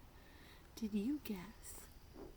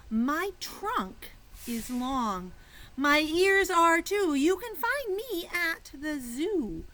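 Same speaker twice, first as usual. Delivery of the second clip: loud, slow storytime voice; excited clue reading; 7 semitones higher, 10 dB louder, much slower than usual. Acted. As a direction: read the clue with big wonder.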